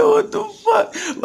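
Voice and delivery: high, slow laughing shout; stunned laughing shout; laughing disbelief. Retold or imitated. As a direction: drag it out, half-crying with laughter. Hearing probation violation called out.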